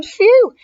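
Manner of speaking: high, loud phew of relief; exaggerated high "phew"; relieved phew. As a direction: let out a big relieved phew. Dog's relief after dragon leaves.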